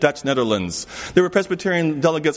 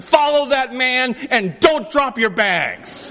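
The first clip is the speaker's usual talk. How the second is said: urgent shout; 9 semitones higher, slower than usual. Retold or imitated. Ordering the group through the terminal, shouting. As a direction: bark it at the group. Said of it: "I said".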